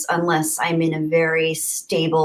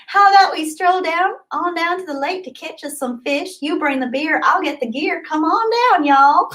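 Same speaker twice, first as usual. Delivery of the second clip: put-on higher, sing-song Southern hillbilly accent; 10 semitones higher than usual. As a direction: play up the Southern twang as an invitation to go fishing. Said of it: "I'm not great at the Southern accent".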